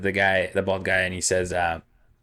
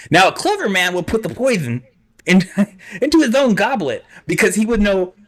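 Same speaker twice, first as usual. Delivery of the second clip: Vizzini voice; 8 semitones higher than usual. Acted. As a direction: reason it out aloud, smug and theatrical. GM plays Vizzini reasoning aloud.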